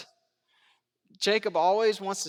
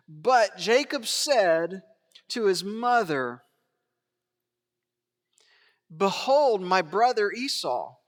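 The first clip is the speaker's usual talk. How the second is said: slow, deliberate reading; slower than usual. Shown as a demonstration. Preacher to congregation, reading slowly and deliberately. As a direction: linger over every word of Jacob's reply. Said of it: "Just read this slowly with me".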